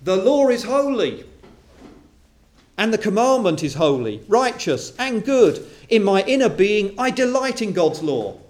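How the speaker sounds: fervent preaching, "righteous and good" stretched slow; preaching at full force. Acted. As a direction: proclaim it like a pulpit climax. Preacher reads Paul at full force.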